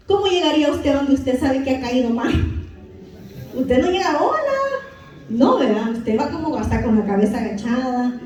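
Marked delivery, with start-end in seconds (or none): 4.2-4.6 bright carefree high hello, as if nothing happened; breezy hello. Retold or imitated. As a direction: toss off a cheery hi. Casual greeting to Jesus.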